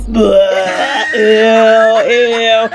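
mock revulsion; disgusted. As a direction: pretend spitting out in disgust. Doll spits out cookie.

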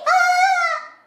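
high loud playful shriek; hammed-up scream; mock scream. Mock scare after scary Mary.

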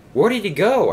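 startled asking where he went; surprised. Acted. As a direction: engines startled, calling out high. Engines surprised Paxton gone.

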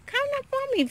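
very high squeal for the dog; squealed call. Real, not acted. Owner squealing at her dog, very high. Peak of the calling.

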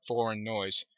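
plain reading voice

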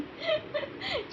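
squealing laugh; high giggle. Real, not acted. Baby throws bottle.

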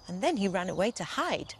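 usual speaking voice

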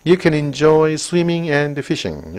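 very slow, clear English read; slow textbook reading; slow reading aloud. Shown as a demonstration. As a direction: read it out word by word for the class. Reads English sentence aloud.

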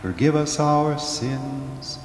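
ordinary talk

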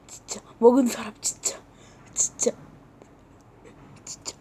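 unvoiced, hushed grumble; whispering revenge to yourself; whispered threat. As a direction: seethe under your breath. Muttering threat after the tantrum.

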